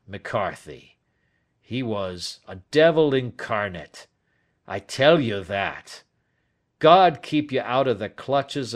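emphatic, lightly voiced bitter old man; bitter old Turner. Old man describing his twenty-year tormentor, bitter. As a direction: denounce your tormentor, loud at the start.

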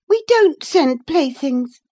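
put-on high, loud indignant girl; proud cry. A narrator's reading. Catherine objecting to Ellen, proud cry. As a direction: cry out in wounded pride. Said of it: "cried Catherine, her pride overcoming her shame".

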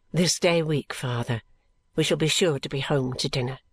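low, softened, deferential promise; dutiful son. A narrator's reading. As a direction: reassure your father meekly.

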